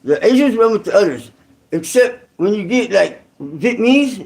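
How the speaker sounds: ordinary delivery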